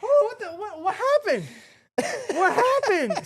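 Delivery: high, laughing what happened; cracking up asking what happened; laughing disbelief. Real, not acted. Reacting to funny play, laughing.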